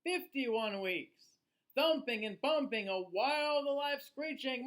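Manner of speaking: put-on lively, sing-song read-aloud for children; storybook reading. A narrator's reading. Reading a rhyming book to children, lively storyteller voice. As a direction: read it like bedtime story time.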